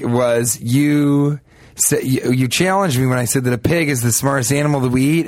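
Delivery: ordinary talk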